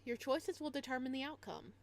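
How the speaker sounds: normal talking voice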